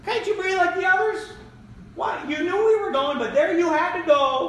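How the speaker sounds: shepherd scolding the stray sheep, high and exasperated; mock scolding shepherd. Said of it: "He does not come to that sheep and say".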